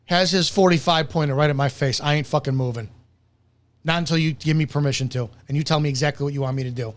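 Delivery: low, hushed and hurried, frozen at gunpoint; a frightened kid who won't move; voicing the kid.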